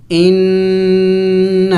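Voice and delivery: ان with the doubled نون humming through the nose; teacher showing ghunna on ان; nasal ghunna. Shown as a demonstration. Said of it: "ناک میں آواز پیدا کریں گے".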